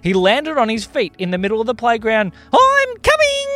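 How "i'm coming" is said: very high, loud stretched call; gleeful charge. A narrator's reading. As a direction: holler it while charging at them. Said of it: "said Tiger Pal as he drove".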